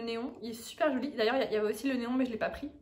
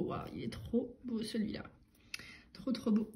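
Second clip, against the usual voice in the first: chuchoté; 10 dB quieter than usual. Real, not acted. Admire une plante, chuchote.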